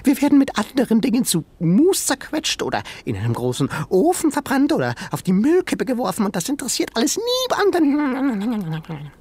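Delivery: high, fast, worked-up tiny-creature voice; the small animal pours out its fear and outrage; agitated little shrew. Acted. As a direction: shrew tells Ben the museum's grim fate, high and agitated. Shrew character voice.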